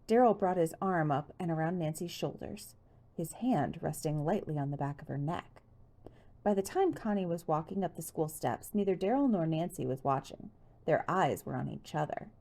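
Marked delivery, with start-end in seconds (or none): none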